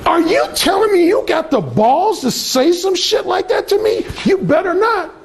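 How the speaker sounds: daring "you better not"; imitating her testing his nerve; challenging woman. Imitating women testing him.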